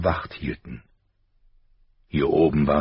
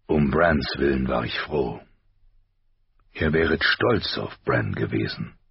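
low-pitched, restrained father; father's voice; 4 semitones lower than usual. A narrator's reading. Husband speaks of pride in his son, low father's voice. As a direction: sink the pitch for the husband's words.

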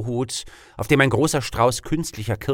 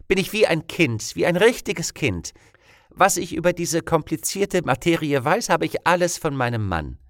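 higher-pitched, cheerfully innocent voice; beaming; 7 semitones higher than usual. A narrator's reading. Teacher explaining her political ignorance to her questioners, beaming. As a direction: beam through a happy confession. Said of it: "strahlte über das ganze Gesicht".

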